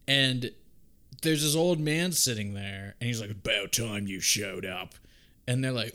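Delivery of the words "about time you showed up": put-on lower, slow old man's greeting; a hint of a weary old guard; gruff old man. Old man voiced in retelling.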